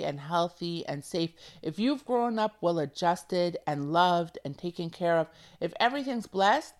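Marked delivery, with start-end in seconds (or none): none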